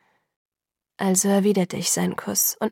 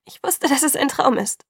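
higher-pitched tearful outburst; sobbing; 5 semitones higher than usual. A narrator's reading. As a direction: sob it out, voice lifting high. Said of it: "schluchzte ich". (hoch und schluchzend gespielt)

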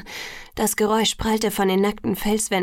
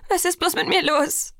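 high-pitched, desperate question; hysterical; 8 semitones higher than usual. A narrator's reading. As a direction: cry it out in distress. (verzweifelt, mit hoher Stimme)

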